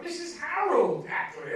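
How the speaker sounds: high, loud, slow "Missus Harold Ackley?" in disbelief; stunned she knows the name; amazed recognition. Retold or imitated. Rita hearing her old teacher's name, loud amazed disbelief. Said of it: "She went".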